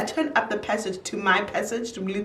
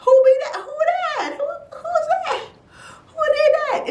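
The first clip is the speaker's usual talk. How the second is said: high, frightened questions; shrill-pitched scared whoever-that-is cry; panicked squeak. Retold or imitated. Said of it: "'cause I was like".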